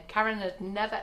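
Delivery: ordinary talk, nothing marked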